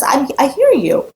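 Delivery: lightly voiced, slow, high acceptance; a hint of Moshe yielding; Moshe agreeing. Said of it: "He says".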